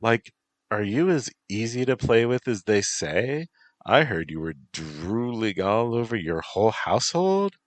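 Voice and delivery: put-on high, teasing valley girl; bubbly valley girl teasing. Bee girl character speaks.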